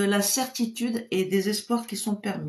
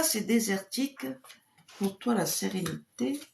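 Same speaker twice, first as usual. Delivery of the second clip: ordinary delivery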